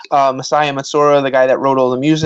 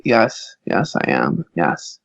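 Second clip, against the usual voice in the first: retold subdued answer; 8 semitones lower, 5 dB quieter, much slower than usual. Retold deadpan answer to accent question.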